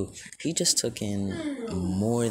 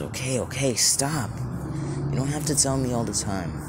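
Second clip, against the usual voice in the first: higher-pitched, mildly exasperated telling-off; acted plea to be left alone; exasperated protest; 6 semitones higher than usual. Deku tells the voice in his head to stop, exasperated.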